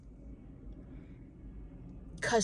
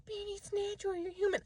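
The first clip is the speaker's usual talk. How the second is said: soothing friend voice; 8 semitones higher, 8 dB quieter, faster than usual. Retold or imitated. Quotes someone's consoling line.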